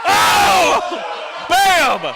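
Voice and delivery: high, loud excited scream. Real, not acted. Commentator screaming at another hole-out, excited. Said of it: "I'm sorry I'm screaming".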